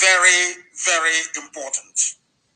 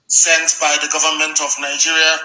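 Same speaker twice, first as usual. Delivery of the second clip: usual speaking voice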